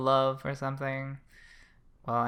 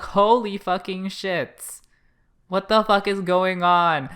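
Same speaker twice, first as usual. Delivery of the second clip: loud, higher-pitched retold shock; stunned outburst; 5 semitones higher, 7 dB louder than usual. Cohost retelling her reaction to the punch, stunned outburst. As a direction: exclaim it in drawn-out, replayed disbelief. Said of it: "And I'm like".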